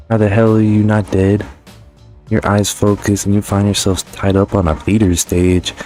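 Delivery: normal talking voice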